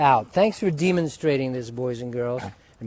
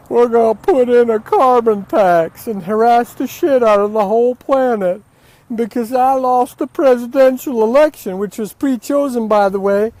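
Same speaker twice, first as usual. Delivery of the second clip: mock Al Gore; 5 semitones higher, 5 dB louder than usual. Mocking Al Gore's carbon tax speech, loud pompous put-on voice. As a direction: ham up a smug, self-righteous politician. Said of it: "think that Al Gore's".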